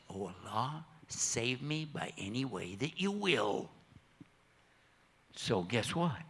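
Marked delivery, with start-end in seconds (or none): 0.1-3.7 hushed prayer. Acted. Boy prays in the storm.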